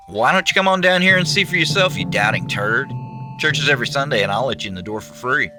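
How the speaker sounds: loud revival preacher pitch; preachy pitch. Pretend. Preacher role continues, preachy invitation.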